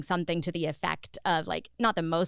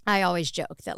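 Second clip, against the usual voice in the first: usual speaking voice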